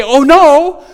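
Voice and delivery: loud, high "Oh no" of mock alarm; mock dismay. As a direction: blurt it out like someone caught off guard. Voices shocked reaction to sin.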